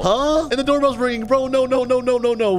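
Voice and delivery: high-pitched mock panic; playful panic. Tenant told of eviction as doorbell rings, mock panic. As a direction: fret aloud, high and hammed-up.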